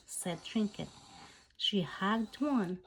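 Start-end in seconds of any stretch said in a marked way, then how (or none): none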